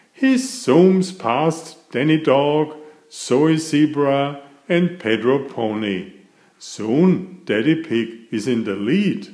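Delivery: plain reading voice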